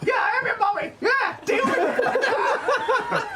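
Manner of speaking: high, hammed-up yell; in-character shout. Goblin answers the clinging kobold, high playful shout. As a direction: yell back high, drawing out the end.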